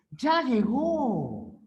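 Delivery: "Ya llegó" slow and high, stressing "Ya", falling to the end. Shown as a demonstration. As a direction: let the tune fall away. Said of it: "El tono es descendente". (tono final descendente)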